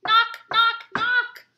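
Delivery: high, loud knocking call. A narrator's reading. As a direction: rap it out loudly. Knocking at the door.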